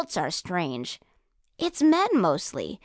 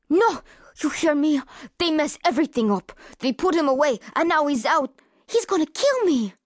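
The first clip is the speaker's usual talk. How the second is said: frantic insistence; 4 semitones higher than usual. A narrator's reading. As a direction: cut him off in fear. Girl whirls around, refuses police.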